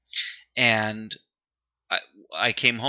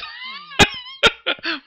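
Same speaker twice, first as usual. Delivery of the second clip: squealing laugh at the teasing; high burst of laughter; 4 dB louder than usual. Real, not acted. Laughing at his friend's teasing, high squealing laugh. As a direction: break into a high helpless laugh.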